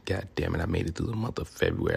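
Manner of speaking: ordinary delivery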